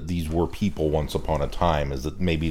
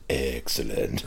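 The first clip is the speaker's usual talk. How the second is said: David Banks Cyber Leader voice; 9 semitones lower, 8 dB quieter, much slower than usual. Retold or imitated. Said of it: "When David Banks goes".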